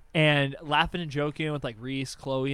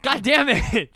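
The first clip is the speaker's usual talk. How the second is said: loud, high exasperated curse; exasperated outburst; 7 semitones higher, 6 dB louder, slower than usual. Real, not acted. Reacting to own cheating story, exasperated. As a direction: burst out cursing in frustration.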